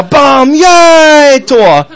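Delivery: high, loud drawn-out cheer; bam, then a long high yell; mock goal cheer. Preacher mimicking himself cheering at the goal DVD, mock goal cheer.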